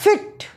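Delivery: फिट with a quick short i. Shown as a demonstration. Teacher showing students a quick short i sound. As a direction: clip फिट short and crisp. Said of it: "जिसको हम जल्दी से बोलेंगे सिट".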